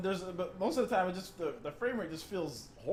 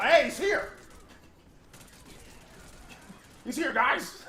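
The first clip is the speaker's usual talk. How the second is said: enemy guard callout; 9 semitones higher, 3 dB louder, much slower than usual. Pretend. Enemies spotting him, acted callout.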